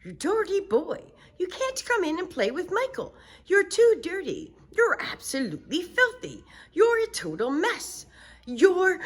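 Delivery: high scolding turning to shock; mother realizing it's her son. Acted. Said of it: "His mother opened the door and said".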